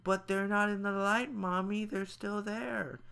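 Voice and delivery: put-on small girl warning her mother; little girl voice. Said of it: "Maddie's like".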